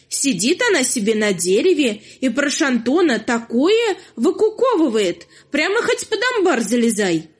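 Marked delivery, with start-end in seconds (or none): none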